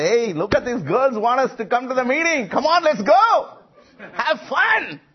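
eager shouted invitation, acted out; calling out to friends. Calling friends over in retold scene.

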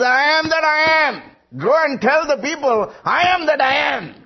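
high, loud shout of God's name; booming proclamation. Acted. Preacher proclaiming God's answer to Moses, shouted high. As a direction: thunder the name out to the people.

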